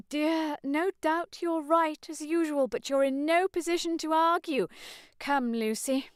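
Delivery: high, fussy scandalised matron; shocked fussy old lady voice; flustered mother. Acted. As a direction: fluster over the scene. Mother scolding.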